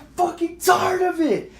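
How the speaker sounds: high retold scream; re-enacted high-pitched fed-up yell; screaming bloody murder. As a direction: scream it as if at breaking point. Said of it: "scream-, like, bloody murder".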